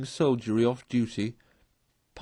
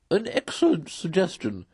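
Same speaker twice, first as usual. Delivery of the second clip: higher, pleased judge's approval; a lightly voiced chairman, approving; approving magistrate; 6 semitones higher than usual. A narrator's reading. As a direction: approve heartily like a pompous chairman. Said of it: "said the chairman approvingly".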